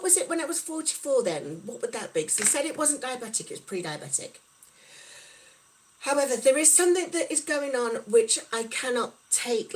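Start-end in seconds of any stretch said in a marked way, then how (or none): none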